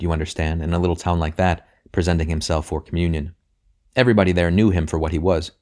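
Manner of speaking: plain reading voice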